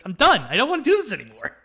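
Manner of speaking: mock fed-up outburst. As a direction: blurt it out loud like you've had enough. Acting hero exhausted by endless ordeal.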